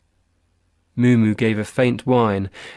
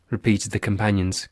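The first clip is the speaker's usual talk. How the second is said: ordinary delivery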